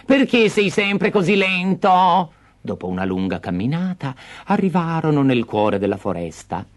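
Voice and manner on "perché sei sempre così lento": high, slow exasperated scolding; impatient stepmother. Acted. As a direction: nag him.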